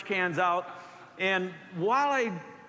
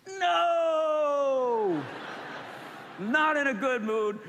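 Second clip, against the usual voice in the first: mock defiant no; 6 semitones higher, much slower than usual. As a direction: blurt it out like a stubborn kid. Refusing the Spirit's nudge.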